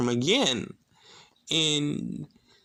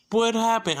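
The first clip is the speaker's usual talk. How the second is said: high, urgent retold question; alarmed nurse; 9 semitones higher, 3 dB louder than usual. Nurse runs in after a fall, alarmed. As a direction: imitate a nurse rushing in, pitched up.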